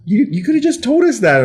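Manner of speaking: hammed-up exasperation; colleagues exasperated. Colleagues to Glenn, high mock exasperation. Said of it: "they're like".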